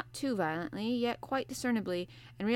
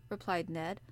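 ordinary delivery, nothing marked

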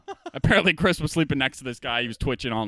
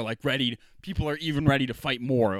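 ordinary talk, nothing marked

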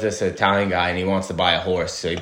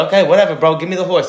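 impatient buyer; 8 semitones higher, 6 dB louder, faster than usual. Retold or imitated. Rich guy caves in joke.

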